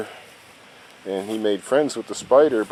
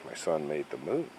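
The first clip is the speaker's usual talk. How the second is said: ordinary talk, nothing marked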